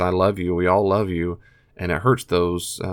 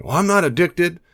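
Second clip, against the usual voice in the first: high, louder defensive denial; defensive addict; 9 semitones higher, 4 dB louder than usual. Pretend. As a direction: voice the addict brushing it off. Addict's retort in role-play.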